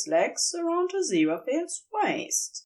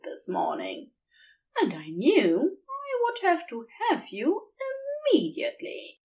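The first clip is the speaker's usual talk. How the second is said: a lightly voiced, higher playful angel; teasing angel; 11 semitones higher than usual. A narrator's reading. Angel flattering his sulking demon lover, lightly voiced higher tease. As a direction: tease him with mock helplessness.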